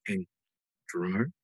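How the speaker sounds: imitating mom's softened office voice; hushed work voice. Mom calling her son from the quiet bank, hushed. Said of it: "she has to keep her voice down".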